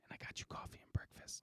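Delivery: breathy whispered aside; whispering. Real, not acted. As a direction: whisper it like a secret.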